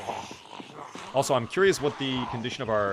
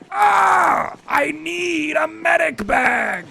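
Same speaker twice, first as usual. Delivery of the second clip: high, drawn-out shouted plea; Payday line shout; 6 dB louder, much slower than usual. Acted. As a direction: yell it hard like a downed heister calling for supplies. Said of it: "can you do a line from Payday two".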